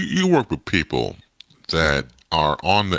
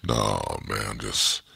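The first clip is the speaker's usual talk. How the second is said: low, soft, mellowed-out radio voice; mock smooth DJ, off mic; slow jam DJ; 10 semitones lower, 7 dB quieter than usual. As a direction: stay in the low late-night DJ croon. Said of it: "you don't have to talk like that now".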